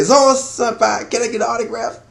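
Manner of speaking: high, softened starstruck plea; high-pitched fanboy voice; gushing fanboy. Acted. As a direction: play an adoring fan begging an idol. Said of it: "is fanboy and say".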